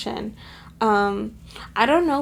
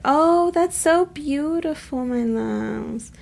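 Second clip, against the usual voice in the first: slow, higher-pitched, drawn-out coo; gushing adoration; 4 semitones higher, much slower than usual. Real, not acted. Reader tells her loves how beautiful the message is, gushing. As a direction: draw it out, melting with affection.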